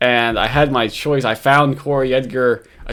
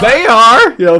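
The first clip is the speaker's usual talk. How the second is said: very high, loud mock outburst; enthusiastic mimicry; 10 semitones higher, 10 dB louder than usual. Host mimicking a very emotional guy, loud and impassioned. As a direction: imitate an over-passionate man, punching it out. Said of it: "Very, uh, enthusiastic, uh, clearly a passionate guy".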